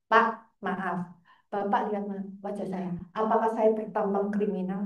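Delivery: low, softened, measured retold question; calm confrontation. Visitor asking the guard if they look criminal, calm and low.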